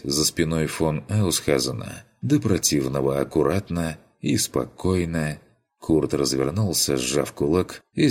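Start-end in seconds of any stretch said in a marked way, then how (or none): none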